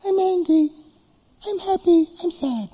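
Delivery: put-on high, sing-song little kid voice; mock elementary-schooler; childish voice. Shown as a demonstration. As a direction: say it like a small child listing feelings. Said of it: "you're speaking elementary".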